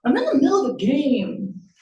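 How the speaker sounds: put-on mildly sulky young son; sulky boy. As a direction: complain like an interrupted child. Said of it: "Gerald enters sulkily".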